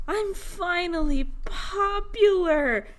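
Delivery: high, slow, drawn-out swoon of triumph; mock elation. Adam finally popular at school, hammed-up elation. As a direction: stretch it out high, overjoyed. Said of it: "And then Adam is just".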